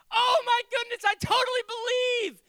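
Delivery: high, loud, elated cry; overjoyed outburst; joyful belief. Acted. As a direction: swept up in sudden faith. Father's joy at believing.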